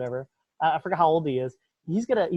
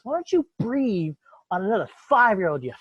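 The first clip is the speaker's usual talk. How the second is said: louder, jeering Trump jab; mock Trump taunt; 5 semitones higher, 4 dB louder than usual. Trump mocking Biden at a debate, louder and jeering. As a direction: sneer it out as a rally insult. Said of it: "he goes".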